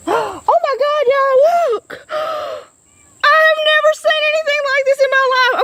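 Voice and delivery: high-pitched, breathless real amazement; amazed excitement. Real, not acted. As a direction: gasp it out as if you've never seen anything like it. Discovering spiders on tree limbs.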